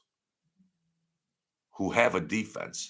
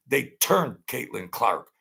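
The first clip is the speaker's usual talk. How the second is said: ordinary talk, nothing marked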